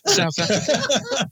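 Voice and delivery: high, slow Kiwi accent. Shown as a demonstration. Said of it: "I haven't got a Kiwi accent".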